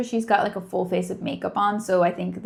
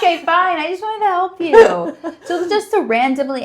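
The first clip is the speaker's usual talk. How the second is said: high, loud mock-hurt giggle; laughing protest; 9 semitones higher, 8 dB louder than usual. Real, not acted. Partner teased for trying to help, laughing protest. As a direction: protest through your laughter.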